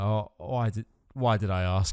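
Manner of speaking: low, lightly acted regret; dropped pitch, mock weary self-reproach; regretful doctor. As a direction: play a doctor sorry he asked. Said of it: "he's just sat there like".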